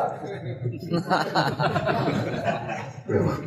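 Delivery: an unvoiced laugh of amusement; long amused laugh; breathy laugh. Real, not acted. Laughs after the krupuk joke.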